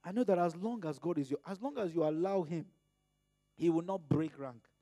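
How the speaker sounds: ordinary talk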